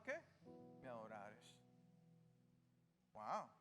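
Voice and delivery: usual speaking voice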